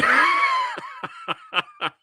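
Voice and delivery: high-pitched burst of laughter; real shrieking laugh. Real, not acted. Host cracking up over [name] saving the segment, shrieking laugh.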